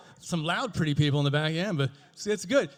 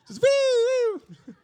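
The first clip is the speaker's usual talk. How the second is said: high loud laughing whoop; party woo; 8 dB louder, much slower than usual. Pretend. Party ambulance on its way, high loud laughing woos. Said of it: "The party bus".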